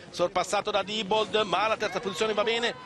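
ordinary delivery

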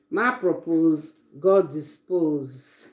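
drawn-out, slow repeat of the proverb. Shown as a demonstration. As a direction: savor each word slowly, like a saying. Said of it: "Now be ma propose, God dispose".

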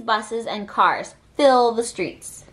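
ordinary delivery, nothing marked

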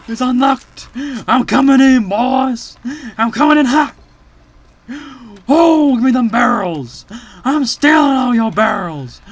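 high, loud raider's holler; excited shouting. Real, not acted. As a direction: charge in yelling with glee. Excited raid on enemy base.

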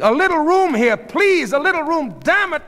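high, loud shouted order; commanding. A narrator's reading. Sheila at the front of the crowd, commanding shout. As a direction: bellow at a crowd to clear the way. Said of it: "was commanding".

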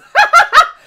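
short high burst of laughter. Real, not acted. Laughing at chat.

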